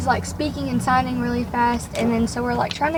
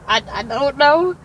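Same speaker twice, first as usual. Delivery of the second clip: helpless, drawn-out stammer, rising at the end; unsure, questioning retold reply. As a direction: stammer it slowly like you're at a loss. Frozen on first day, at a loss.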